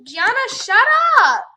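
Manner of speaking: high, loud shout of shut up; furious shout at her sister Gianna; yell. Real, not acted. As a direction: scream at Gianna to stop. Yelling at sister.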